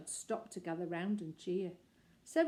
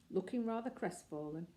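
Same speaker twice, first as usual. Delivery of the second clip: ordinary delivery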